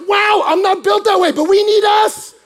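high, full-voiced shout; big hammed-up yell; shouted amazement. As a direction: cry it out, stretching the end. Shouted punchline and appeal.

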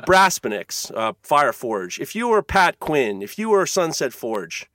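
usual speaking voice

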